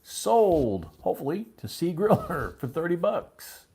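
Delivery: high, loud call with a chuckle inside; auctioneer's sale announcement. Real, not acted. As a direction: proclaim the sale, letting a laugh slip in.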